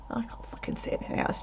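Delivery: hushed, breathy whisper. Retold or imitated. Looking out the window at night, whispering. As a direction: whisper while squinting out the window.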